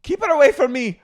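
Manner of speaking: very high, loud Irish shriek; Vicky as an old Irish innkeeper, shrieking. Retold or imitated. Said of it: "She's an old Irish innkeeper".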